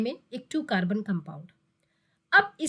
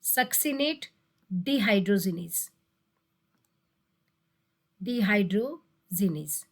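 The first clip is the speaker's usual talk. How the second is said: slow repeated naming; 4 semitones lower, slower than usual. Shown as a demonstration. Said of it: "ये दो enzyme बहुत बार पूछे गए हैं".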